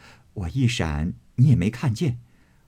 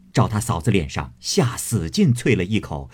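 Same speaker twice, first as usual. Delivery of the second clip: ordinary delivery, nothing marked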